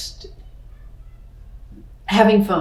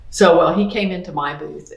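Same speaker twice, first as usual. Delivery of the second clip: normal talking voice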